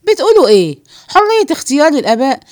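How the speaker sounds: loud, incredulous echo; outraged disbelief. Acted. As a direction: repeat their idea back as a scandalised question. Outraged echo of the men's idea. (استنكار واندهاش بصوت عالي)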